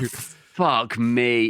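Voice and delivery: ordinary talk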